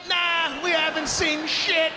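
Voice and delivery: high, loud fake-innocent reply; mock innocent denial. Family lying to the neighbors, high loud fake innocence. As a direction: shout it up high, playing dumb.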